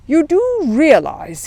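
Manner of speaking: slow, weighty policeman warning; stern warning. Acted. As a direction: lean on each word. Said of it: "he said".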